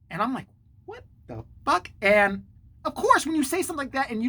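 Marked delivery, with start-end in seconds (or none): 0.8-1.9 high, drawn-out disbelief; slow it down, pitched up, baffled; mock bewilderment. Reacting to the outburst in the retelling, acted bewilderment. Acts out own stunned reaction.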